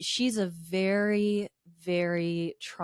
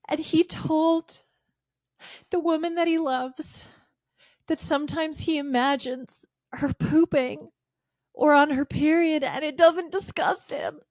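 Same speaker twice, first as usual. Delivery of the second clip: mock emotional; 9 semitones higher, 3 dB louder than usual. Mock-moved sarcastic praise.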